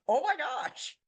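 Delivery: high, breathless "oh my gosh"; starstruck gasp. Retold or imitated. Retelling bumping into Austin in the washroom, starstruck and gasping. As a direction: gasp as if face to face with a legend.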